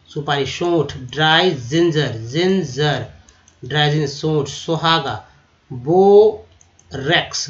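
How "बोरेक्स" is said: बोरेक्स stretched slowly and louder, "bo-reks"; louder slow demo. Shown as a demonstration. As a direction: announce बोरेक्स clearly. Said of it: "बोरेक्स का मतलब सुहागा". (बोरेक्स ज़ोर से धीरे बोलें)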